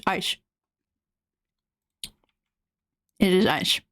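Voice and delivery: talking while crunching ice. Real, not acted. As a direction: mouth full of crunched ice. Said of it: "I'm crunching so loudly".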